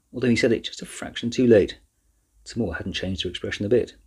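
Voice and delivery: plain reading voice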